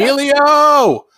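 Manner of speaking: drawn-out high shout of a name; excited shout. Real, not acted. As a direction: belt the name out slow and high. Calling out name.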